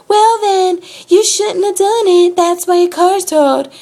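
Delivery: high, loud, drawn-out country twang; mock her scolding country-accent yell. Tow lady tells customer why car's towed, yelling country accent. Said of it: "yelling at me in her little accent".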